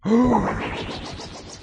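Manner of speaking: high, drawn-out mock gasp. Reacts to reveal in the game.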